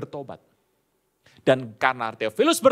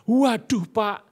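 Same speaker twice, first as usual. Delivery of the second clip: higher, louder mock groan of dismay; a touch of a startled student; 7 semitones higher, 6 dB louder, slower than usual. Student hears he must restart his thesis: mock dismay.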